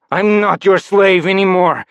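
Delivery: high, loud defiance; defiant. Acted. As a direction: throw off the master's chains. Rebel defies former master.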